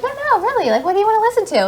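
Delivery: high, sweet coaxing voice; sweet nurse voice. Retold or imitated. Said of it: "They're like".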